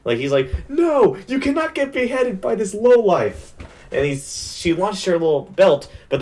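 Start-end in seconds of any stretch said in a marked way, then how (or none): 0.7-3.5 high-pitched, hammed-up indignation; outraged protest. Fan voicing a demon attacking to stop a beheading, outraged protest. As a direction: squeal it in mock outrage.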